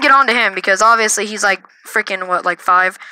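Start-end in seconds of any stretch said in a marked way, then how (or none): none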